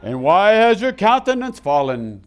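thundering voice of the Lord; voice of God. Acted. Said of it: "Can y'all give me some reverb".

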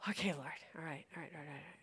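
whispered under the breath; hushed, resigned whispering to God; muttered prayer. Retold or imitated. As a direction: whisper it to yourself, trailing away. Muttered prayer while someone talks on.

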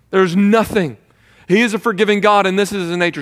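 loud, high, driving sermon voice; full-force preaching. Acted. Pastor to the congregation about God's forgiveness, preaching loud and high.

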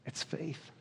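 hushed, whispered revelation. Real, not acted. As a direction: drop to a whisper for the key answer. Preacher drops to whisper for answer.

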